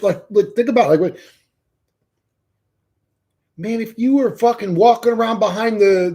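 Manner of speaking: usual speaking voice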